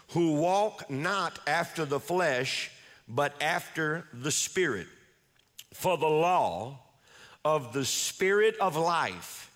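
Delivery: slow, solemn verse reading; scripture reading. Acted. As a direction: read each phrase slowly and deliberately. Reads the verse slowly.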